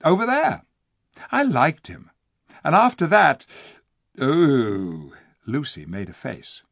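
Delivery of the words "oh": ordinary delivery, nothing marked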